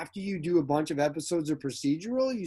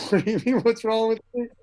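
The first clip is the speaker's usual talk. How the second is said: puzzled protest; 7 semitones higher, 6 dB louder than usual. Retold or imitated. Actor answers director back.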